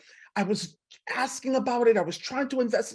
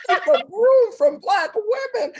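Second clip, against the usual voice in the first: playfully exaggerated plea, much higher and louder; mock high warning voice; 13 semitones higher, 8 dB louder, slower than usual. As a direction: repeat it high, loud and slow, hamming up the friend's plea. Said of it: "who said".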